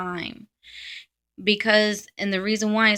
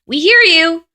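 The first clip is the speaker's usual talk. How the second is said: loud outburst; 9 semitones higher, 10 dB louder than usual. Real, not acted. Ranting about annoying contradictors.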